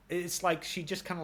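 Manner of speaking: ordinary delivery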